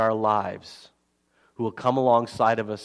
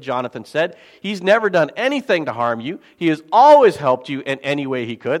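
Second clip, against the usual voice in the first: scripture reading; 4 semitones higher, 4 dB louder than usual. Acted. Pastor reads scripture aloud.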